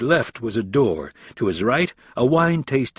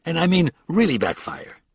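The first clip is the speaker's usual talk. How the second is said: with an edge; 7 semitones higher than usual. A narrator's reading. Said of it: "Green said with an edge".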